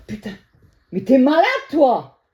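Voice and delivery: real frightened shout, high and loud; yelling at the husband who crept in; startled yell. Real, not acted. Startled woman yells at husband entering while she films. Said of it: "désolée, hein, d'avoir crié".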